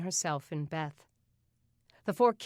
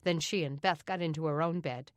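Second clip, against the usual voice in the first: plain reading voice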